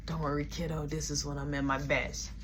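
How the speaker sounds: put-on low mumbling drunk reassuring the kid; drunk dude. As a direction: play the tipsy overconfident stranger. Drunk stranger voiced.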